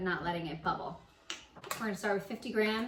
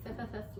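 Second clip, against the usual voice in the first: stutter; 6 dB quieter, faster than usual. Shown as a demonstration. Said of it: "I stuttered".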